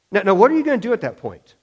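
usual speaking voice

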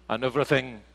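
ordinary talk

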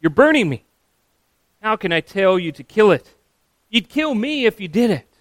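loud, high-pitched panicked protest; acted scream. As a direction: cry out as if being burned. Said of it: "The man with the lizard screams".

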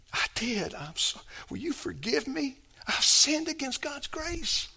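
acted contrite plea. Believer asks brother or sister's forgiveness, contrite. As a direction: act out begging forgiveness, hushed and halting.

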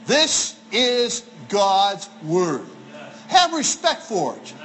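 very slow, word by word; deliberate, spaced-out declaration; slow, weighty emphasis. Shown as a demonstration. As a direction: set each word apart with reverence. Solemn emphasis on reverence for scripture.

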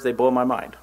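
ordinary talk

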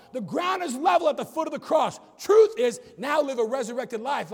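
climbing high, loud preacher's call; preaching at full force. Acted. Pastor driving home grace and truth to the church, full-force preaching. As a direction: build it to the rafters.

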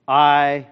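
"I" loud and standing alone; emphatic. Shown as a demonstration. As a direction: set "I" apart with force. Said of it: "receives some emphasis".

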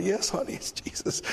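soft, fading to a whisper; gently answering a small child; tender reply. Retold or imitated. Preacher answering a little girl softly, tender. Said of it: "I'm like".

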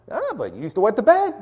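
high-pitched needling comeback; mock taunting. Accuser dismissing a protest about long ago, mock taunting. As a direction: insist on the old shame, voice lifted.

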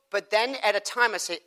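ordinary talk

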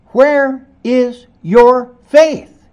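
slow, loud, stern reproach; Jesus rebuking the disciples for lack of faith, stern; rebuke. Acted. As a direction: drag it out as a reprimand. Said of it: "He rebuked them".

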